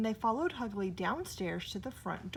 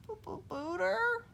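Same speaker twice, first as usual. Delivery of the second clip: scared whisper; 11 semitones higher than usual. A narrator's reading. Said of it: "he whispered".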